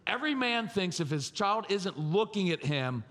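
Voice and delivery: usual speaking voice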